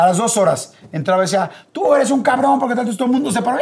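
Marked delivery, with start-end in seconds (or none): 1.7-3.6 high angry yell at the host; retold shout. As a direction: bark it up high like a furious heckler. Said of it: "Gritó". (grito agudo y enojado del doble)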